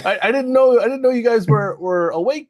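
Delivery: stammering, high nervous squeak; flustered and jumpy. Acted. Forrest voiced nervously.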